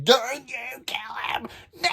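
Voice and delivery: high, crying shout, drawn out; screaming. Retold or imitated. A reader screaming at her book to spare Caesar, crying shout. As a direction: scream at the page to stop the kill. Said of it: "I was screaming".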